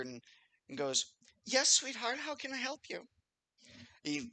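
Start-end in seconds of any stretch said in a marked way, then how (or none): 1.5-3.0 high-pitched, sweet barmaid voice; kindly older lady. Acted. Barmaid answering the barkeep's call, kindly older lady. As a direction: play a motherly older barmaid, pitched well up. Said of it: "very kindly looking older lady comes out".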